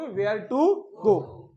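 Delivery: very slow, words spaced out; teacher modelling the answer slowly; slow model answer. Shown as a demonstration. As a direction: lay it out for the class. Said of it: "तो आप क्या बोलोगे?".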